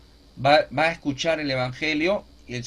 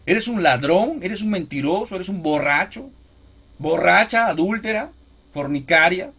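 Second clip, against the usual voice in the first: loud, accusing delivery of Scripture; imitating stern preaching, hit each accusation hard; mock denunciation, raised voice on the charges; forceful rebuke; 4 semitones higher, 6 dB louder than usual. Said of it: "cuando te hablan fuerte".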